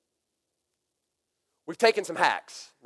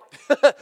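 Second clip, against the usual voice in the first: surprised laugh; 10 semitones higher, 10 dB louder than usual. Real, not acted. Stunned by daughter's offer.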